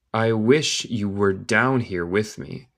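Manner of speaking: whole sentence slowly, without long gaps; slow connected reading. Shown as a demonstration. Teacher repeating the line for learners, slow and connected. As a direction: join the words up at a learner's pace.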